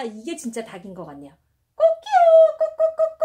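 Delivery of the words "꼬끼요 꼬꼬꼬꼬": high, loud mock crow then clucking; rooster crow. Mom crowing like a rooster for her child. Chicken capsule, mom imitates it.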